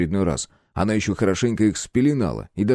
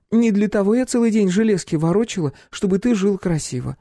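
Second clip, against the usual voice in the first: playful threat; 9 semitones higher than usual. A narrator's reading. Klyopka threatening captive.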